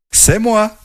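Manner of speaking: high little voice, proud; small ant voice. Acted. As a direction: speak like a tiny ant. Tiny ant answers. (petite voix aiguë de fourmi)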